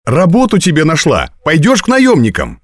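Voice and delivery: put-on high, eager girl's delivery; excited announcement. A narrator's reading. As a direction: announce the good news eagerly, pitched high. Girl announces job news.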